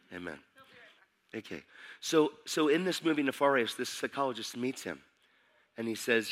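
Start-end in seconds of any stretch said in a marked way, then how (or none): none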